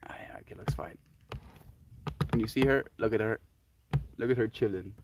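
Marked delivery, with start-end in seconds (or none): 0.1-1.0 whispering. Real, not acted. Checking on cat quietly.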